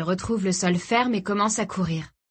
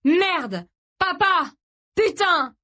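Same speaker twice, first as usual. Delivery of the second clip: high, desperate outburst; panicked swearing; 11 semitones higher, 4 dB louder, much slower than usual. A narrator's reading. Son kicking the sand beside his father, frantic cursing. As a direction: cry out curses in helpless panic.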